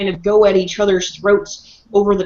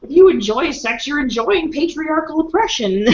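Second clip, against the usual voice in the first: mocking lecture voice; 6 semitones higher than usual. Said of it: "and you're like".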